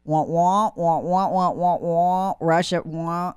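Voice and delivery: Peanuts adult wah wah voice; muffled trombone wah wah mockery; muffled wah wah talk. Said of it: "everything after that's like peanuts".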